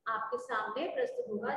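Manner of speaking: normal talking voice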